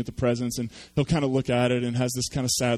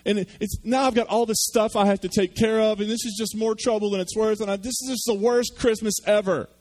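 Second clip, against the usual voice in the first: gloomy son complaining to his father about gifts; whiny pessimistic son; 7 semitones higher than usual. Acted. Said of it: "And he says".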